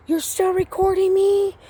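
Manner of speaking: high-pitched mock disbelief; retold indignant challenge. Acts out his challenge.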